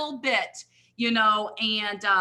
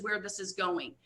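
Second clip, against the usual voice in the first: ordinary talk, nothing marked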